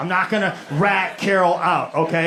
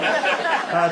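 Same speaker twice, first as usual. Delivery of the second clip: usual speaking voice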